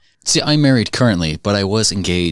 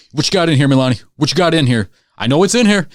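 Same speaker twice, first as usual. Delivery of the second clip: high, loud mock drill-inspection voice; raised-voice sergeant impression, building higher; demanding sergeant; 7 semitones higher, 4 dB louder than usual. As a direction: imitate a sergeant demanding answers, pitch climbing. Sergeant confronts him during room inspection.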